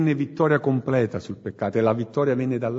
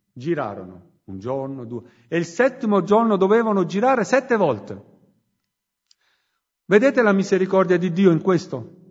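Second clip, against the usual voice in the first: fiery preaching; 3 semitones higher than usual. Acted. Preacher urging the congregation to repent, full-force preaching. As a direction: preach it out to the whole church.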